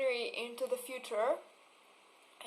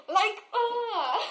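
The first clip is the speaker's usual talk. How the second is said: frustrated laughing cry; 9 semitones higher, 6 dB louder than usual. Real, not acted. Exasperated outburst after imitation.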